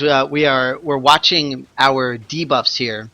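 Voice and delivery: normal talking voice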